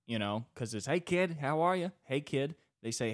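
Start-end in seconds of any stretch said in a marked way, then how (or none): 0.9-2.5 loud, higher Boston-guy greeting. Retold or imitated. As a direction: call out hey kid like a Boston local. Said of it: "Guys from Boston are maybe the best in our nation at saying hello".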